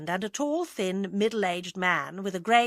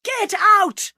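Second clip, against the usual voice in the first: top-of-the-voice roar, pitched high; furious shout; 14 semitones higher, 13 dB louder than usual. A narrator's reading. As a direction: scream them out of the house in rage. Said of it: "shouted at the top of his voice".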